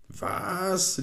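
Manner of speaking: high-pitched, drawn-out disbelief; outraged cry. A narrator's reading. Insulted human man answers sprite, outraged cry. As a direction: shoot up high in indignation. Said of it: "rief der Menschenmann aufgebracht".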